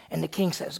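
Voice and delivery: ordinary talk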